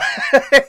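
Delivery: sudden high laugh; high burst of laughter. Real, not acted. Laughing at the movie scene, high bursting laugh.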